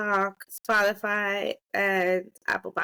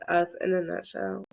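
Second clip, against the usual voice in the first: ordinary talk, nothing marked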